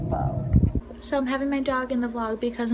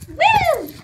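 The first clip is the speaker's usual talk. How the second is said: excited cheer; 11 semitones higher than usual. Real, not acted.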